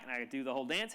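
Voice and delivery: ordinary talk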